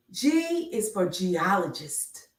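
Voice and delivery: usual speaking voice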